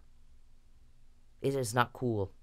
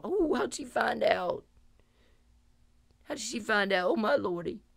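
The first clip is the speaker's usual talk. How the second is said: mock surprised creep; 5 semitones higher, 5 dB quieter than usual. Mocking Perry when he's caught, a higher, soft fake surprise. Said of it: "and you're going".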